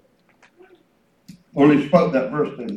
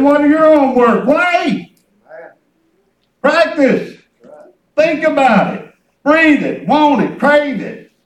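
raised voice, punching short commands; fervent preaching; 8 semitones higher, 6 dB louder than usual. Real, not acted. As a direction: urge the congregation on phrase by phrase. Preaches with full voice.

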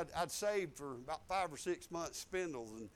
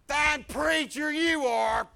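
loud, higher, drawn-out mock scolding; loud scornful jab; 7 semitones higher, 13 dB louder, much slower than usual. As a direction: imitate a wife yelling a slow, cutting jab. Wife's retort acted out.

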